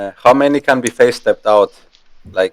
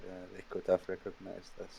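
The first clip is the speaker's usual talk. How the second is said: whispering; 3 semitones lower, 26 dB quieter than usual. Acted. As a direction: whisper it to the hands. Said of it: "I'm just whispering".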